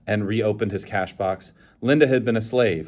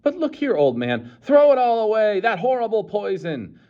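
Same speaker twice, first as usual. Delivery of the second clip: high, loud urging; urgent plea; 12 semitones higher, 3 dB louder than usual. A narrator's reading. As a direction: beg them to throw it away. Savage pleads to crowd.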